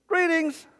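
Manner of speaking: high, comic hello; mock greeting. Preacher reads out what Jesus said, mock greeting. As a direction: sing it out in playful mockery. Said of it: "but what's it say?".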